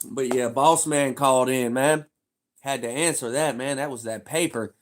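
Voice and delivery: ordinary talk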